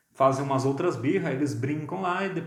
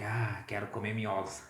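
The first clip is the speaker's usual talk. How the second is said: low, soft zombie groan; mock zombie moan; 6 semitones lower, 8 dB quieter than usual. Zombie from old films craving brains, low groaning. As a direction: drag it out like a brain-hungry zombie. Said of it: "Eles estão atrás de miolos".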